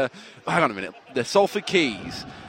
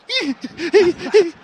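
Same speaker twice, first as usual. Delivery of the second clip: put-on baby giggle; 12 semitones higher, 4 dB louder than usual. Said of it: "And he goes".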